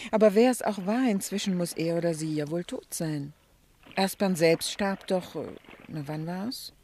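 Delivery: ordinary talk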